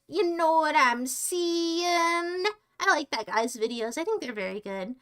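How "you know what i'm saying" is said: put-on creepy old man. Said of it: "and he's like".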